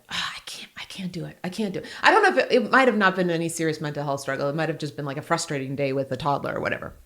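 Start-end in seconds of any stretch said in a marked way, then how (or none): 0.1-1.9 whispered, worn-out I can't do it; despairing whisper. Retold or imitated. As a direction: let the words barely come out.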